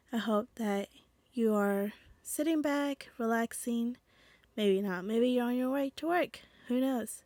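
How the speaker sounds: ordinary delivery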